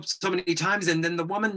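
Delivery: normal talking voice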